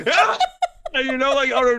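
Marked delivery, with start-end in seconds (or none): 0.1-0.4 high, loud yell of rage; mock rage yell. Retelling near-punching the wall, a rage yell. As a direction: let out a sudden rage shout. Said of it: "I was like".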